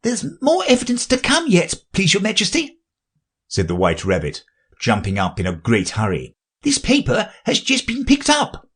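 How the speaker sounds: high, hurried little rabbit voice. A narrator's reading. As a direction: fuss in like a nervous courtier.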